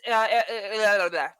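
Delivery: real tongue-tied fumbling. Real, not acted. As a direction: stumble and lose your words. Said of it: "I'm getting tongue-tied".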